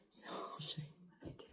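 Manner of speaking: late-night whisper so nobody wakes; hushed whisper, parents asleep nearby. Real, not acted. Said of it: "Sorry, I'm whispering".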